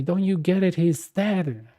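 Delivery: lightly voiced irritated widow; grieving spouse snapping at a collector, mildly imitated; annoyed spouse. Spouse snaps at debt caller.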